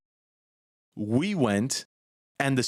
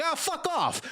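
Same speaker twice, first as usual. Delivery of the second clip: much higher dismissive gangster; mock mobster brushoff. The mobster dismisses the car's voiceover, in a high mock gangster brushoff. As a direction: flick it off like a cartoon crook. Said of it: "He's like".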